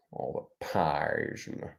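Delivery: soft, low Grinch grumble. Retold or imitated. The Grinch listing Christmas things he hates. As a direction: mutter it with disgust as the Grinch. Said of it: "Jim Carrey Grinch impersonation".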